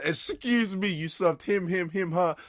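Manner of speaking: loud, high-pitched mock complaint; indignant customer. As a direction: imitate an entitled customer tallying a grievance.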